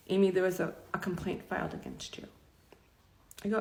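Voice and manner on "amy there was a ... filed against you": quiet retold delivery of bad news; softly, reluctant to deliver it; hushed bad news. HR manager tells employee of a complaint, hushed.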